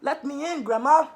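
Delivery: merry call at the door; called merrily. Acted. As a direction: call out happily to Grandma. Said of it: "she called merrily".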